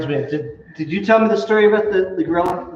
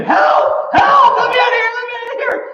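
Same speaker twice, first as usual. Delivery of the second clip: high, loud panicked screams; terrified yells for help; screaming for help; 4 dB louder than usual. Acted. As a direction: shriek for help like you're about to be eaten. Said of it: "he starts screaming".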